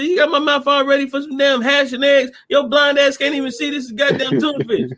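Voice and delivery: high-pitched mock complaint; imitated scolding. Hungry woman berates Benny over the promised hash, mock scolding. Acting out movie couple's quarrel.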